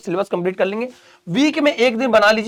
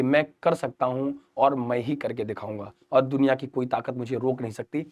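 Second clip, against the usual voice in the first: low, hushed vow to oneself; self-affirmation; 8 semitones lower, 8 dB quieter than usual. Acted. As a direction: say it low and soft, as if to your own reflection. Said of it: "ये बोलो खुद से". (खुद से धीमे स्वर में संकल्प)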